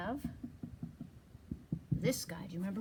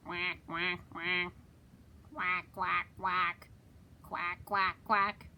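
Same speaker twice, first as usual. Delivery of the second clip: low duck quack; 5 semitones lower, slower than usual. Shown as a demonstration. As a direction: lower quack quack. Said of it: "what do they say".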